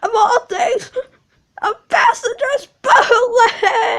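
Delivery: wailing cry of shame. Acted. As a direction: sob it out in despair. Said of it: "Some tears spelled out".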